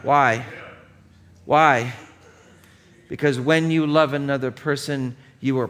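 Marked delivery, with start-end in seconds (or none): 0.0-1.9 loud, insistent rhetorical why; forceful why. Real, not acted. Preacher presses the congregation with a loud rhetorical why. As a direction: hammer the question home to the congregation.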